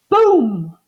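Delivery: loud, punchy boom; sudden boom. A narrator's reading. Floor gives way under the bed, loud boom. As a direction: hit it like a crash. Sound effect in read-aloud story.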